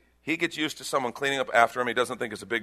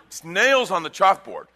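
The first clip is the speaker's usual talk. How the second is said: ordinary delivery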